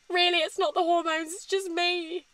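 high, tearful voice after a small laugh; choking up. Real, not acted. Vlogger blaming herself for breaking down, high and tearful. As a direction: try to joke through welling tears. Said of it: "I just can't hold it in".

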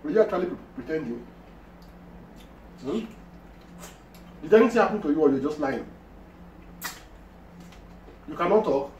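talking between swallows; mouth full, chewing. Real, not acted. Seen 1: Man talks while repeatedly putting fufu balls into his mouth.

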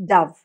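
ഡവ് said short, like "duv"; ഡവ് with a short clipped vowel, the bird; dove the bird. Shown as a demonstration. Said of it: "നമ്മൾ പ്രാവിന് ഡോവ് എന്നല്ല പറയാം".

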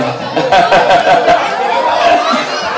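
long rolling laugh. Real, not acted. Host calling fans to run over, laughing. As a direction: laugh in a run of quick ha-has.

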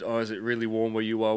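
ordinary delivery

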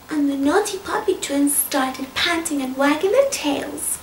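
plain reading voice

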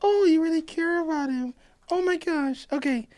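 high airy mock-Serena voice, far above his own; mock a breezy, fake-caring friend brushing it off; imitating Serena. Friend mocks Serena dodging Blair's worry, high mock-sweet voice. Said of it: "And Serena's like".

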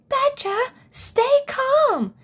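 a bear shouting at an overexcited friend. A narrator's reading. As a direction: shout up at him to stay calm. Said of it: "shouted Bo Bear".

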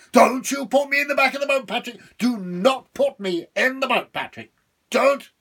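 high, loud, threatening devil; furious devil. Acted. Boxed devil warning Patrick not to do it. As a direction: warn him with fury.

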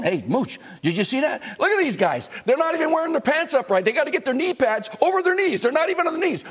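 an agitated coach ranting down the phone; imitating John Madden. Madden calling Mooch during a game, excited high rant. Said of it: "He would call on the phone".

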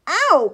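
loud, high "ow"; sudden yelp of pain; pained cry. A narrator's reading. Drake walking into something hard, crying out. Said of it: "cried Drake".

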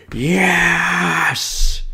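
long, high, drawn-out cheer; gleeful cheer. Acted. Kid told they can stay home sick, gleeful cheer. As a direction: celebrate it on one held high note.

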